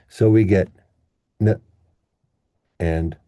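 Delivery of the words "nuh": "n" as a quick nuh with schwa; short, weak syllable. Shown as a demonstration. Teacher showing unstressed syllable, quick weak nuh. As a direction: drop a tiny unstressed "n" plus uh. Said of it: "we will say them short".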